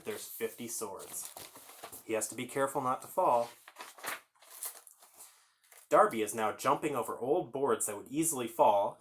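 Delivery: ordinary delivery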